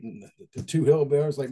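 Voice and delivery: usual speaking voice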